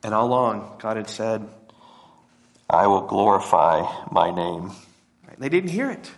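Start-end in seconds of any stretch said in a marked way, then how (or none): 2.7-4.7 voice of God. Acted. God's voice quoted again, low.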